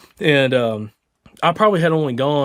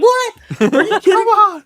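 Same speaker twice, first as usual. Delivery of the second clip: much higher, louder stunned squeal; shocked disbelief; 7 dB louder, slower than usual. Retold or imitated. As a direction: imitate a friend who can't believe it. He's like.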